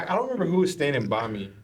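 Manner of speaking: ordinary talk, nothing marked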